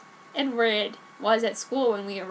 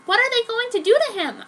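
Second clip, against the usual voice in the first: higher, louder worried question; Joan worried; 10 semitones higher, 6 dB louder than usual. Acted. Joan asking Alice in alarm, higher. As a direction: ask in a rush of fear.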